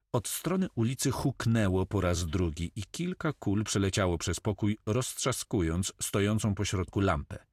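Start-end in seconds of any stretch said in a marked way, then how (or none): none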